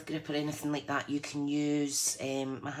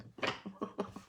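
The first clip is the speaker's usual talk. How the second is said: witchy cackle; 4 semitones higher, 8 dB quieter than usual. Real, not acted. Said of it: "sounds like a right witchy laugh".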